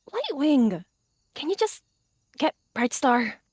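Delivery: high-pitched, impatient call; exasperated huff. A narrator's reading. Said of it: "she huffed".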